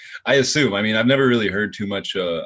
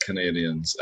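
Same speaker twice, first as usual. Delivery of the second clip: usual speaking voice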